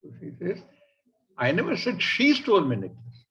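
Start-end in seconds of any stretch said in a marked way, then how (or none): 1.4-3.2 "she" raised and held, the rest falling; stress on SHE. Shown as a demonstration. Lady saying somebody else stole it, stressing "she". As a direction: put all the weight on "she". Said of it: "Somebody stole my necklace".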